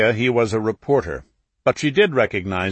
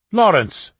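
sharp, loud, high call of her son's name; a mother cutting her son off, loud; objecting cry; 10 semitones higher, 6 dB louder than usual. A narrator's reading. Mother stops her son with a sharp cry. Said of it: "his mother objected".

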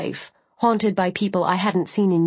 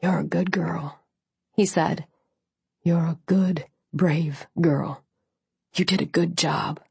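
gentle soldier; 2 semitones lower than usual. A narrator's reading. Wounded soldier comforting her.

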